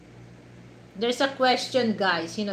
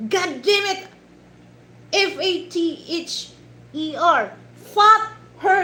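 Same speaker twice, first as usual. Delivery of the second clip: high, loud hammed-up exasperation; 8 semitones higher, 7 dB louder than usual. Upset dad from a funny video, mock angry spelling. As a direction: imitate an angry dad spelling, exploding at the end.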